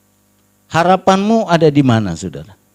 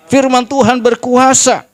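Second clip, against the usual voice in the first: raised, ringing preacher's proclamation; forceful declaration; 7 semitones higher, 4 dB louder than usual. Real, not acted. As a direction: proclaim it to the whole congregation. Same declaration, repeated with force. (berseru lantang penuh keyakinan)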